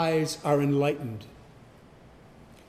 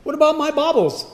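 much higher, quick fretting whine; mock whine; 11 semitones higher, 6 dB louder, faster than usual. Preacher mocks a proud person fretting over baubles, high whine. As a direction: whimper over lost toys.

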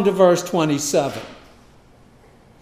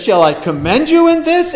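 much higher, louder scornful question; indignant question; 12 semitones higher, 6 dB louder than usual. Acted. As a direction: ask it with mounting scorn. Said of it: "And then he says".

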